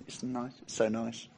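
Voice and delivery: very soft, low aside; quiet mock approval. Listeners politely approving the preacher's answer, very quietly. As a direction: murmur faint, polite approval.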